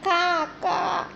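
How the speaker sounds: high cawing crow call; high shouted ka ka crow call. Shown as a demonstration. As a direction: caw out like a crow. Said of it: "the crow sound is".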